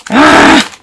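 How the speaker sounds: sudden high yelp of alarm; a quick, loud, high cry as it slips; startled yelp. Real, not acted. Trimming goes wrong, she yelps.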